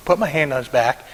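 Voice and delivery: usual speaking voice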